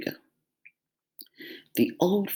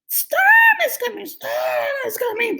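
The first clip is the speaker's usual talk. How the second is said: very high, loud chorus cry; animals crying out together; 4 dB louder than usual. A narrator's reading. Animals all warn the sleepy cat. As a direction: wail it like a frightened crowd. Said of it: "the animals told her all at once".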